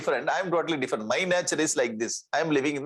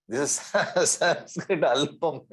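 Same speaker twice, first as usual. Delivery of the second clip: slow words broken by a laugh; chuckling; much slower than usual. Real, not acted. Teacher explaining the Sanskrit word alpam, chuckling. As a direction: laugh gently through the explanation.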